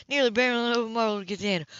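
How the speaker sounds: ordinary delivery, nothing marked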